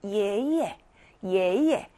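first 예 rises, second 예 comes back down; 예 예 as up, then down; rising then neutral tone. Shown as a demonstration. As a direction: lift 예, then let the repeat drop lightly. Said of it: "그러면 다시 내려와야죠".

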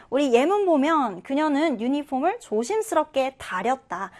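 normal talking voice

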